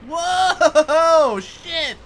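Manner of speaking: high loud shocked yelp; startled outburst; surprised. Real, not acted. Sudden hit in the game.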